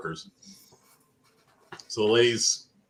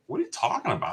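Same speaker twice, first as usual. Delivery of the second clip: retold disbelief; 9 semitones higher, 3 dB quieter, faster than usual. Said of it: "I was like".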